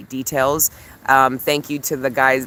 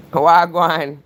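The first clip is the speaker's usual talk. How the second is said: high, loud Jamaican greeting; Jamaican accent; 5 semitones higher, 6 dB louder than usual. Shown as a demonstration. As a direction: show off the Jamaican greeting, loudly. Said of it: "that's how you say it".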